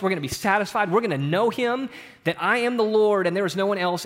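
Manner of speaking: ordinary talk, nothing marked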